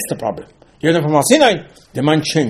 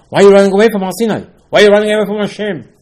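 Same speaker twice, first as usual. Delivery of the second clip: fast, loud, high exasperated rebuke; Moshe's rebuke; 7 semitones higher, 6 dB louder, faster than usual. Acted. Moshe scolding the people for fleeing Har Sinai, loud and fast. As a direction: fire it at them like a scolding leader.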